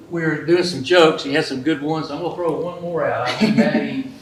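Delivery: loud, pumped-up showman boast; excited hype. Real, not acted. Sponsor hyping his joke record to the audience, loud and excited.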